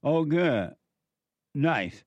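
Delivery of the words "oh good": usual speaking voice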